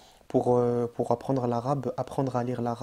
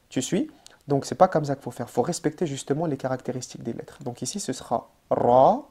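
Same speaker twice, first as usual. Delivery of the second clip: ordinary talk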